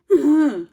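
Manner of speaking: a sudden loud laugh at her own slip; loud burst of laughter. Real, not acted. Laughing at her own biology mix-up, loud laugh.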